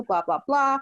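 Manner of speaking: ordinary delivery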